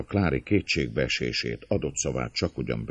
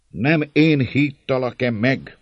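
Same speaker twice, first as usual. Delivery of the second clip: high, raised, forceful question; strong voice; 9 semitones higher, 5 dB louder than usual. A narrator's reading. András addressing the crowd at the house, strong voice. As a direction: project it with authority. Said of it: "szólt erős hangon".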